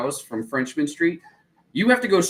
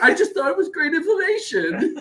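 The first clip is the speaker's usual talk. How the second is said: laughing shout; 14 semitones higher than usual. Retold or imitated. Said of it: "She's like".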